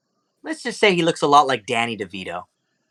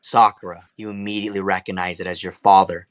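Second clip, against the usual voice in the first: normal talking voice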